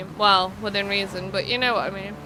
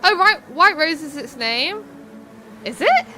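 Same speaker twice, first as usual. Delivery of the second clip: surprised; 5 semitones higher, 3 dB louder than usual. Real, not acted. Surprised at the centre's name.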